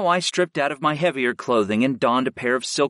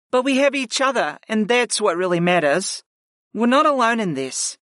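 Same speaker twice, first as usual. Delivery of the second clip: higher, gentle woman's voice; comforting woman's voice; 9 semitones higher than usual. Acted. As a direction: comfort them, pitched up. Layla comforts.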